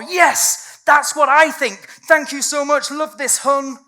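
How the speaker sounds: high, loud gushing agreement; hammed-up gushing fan, slow. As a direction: coo it like an adoring follower.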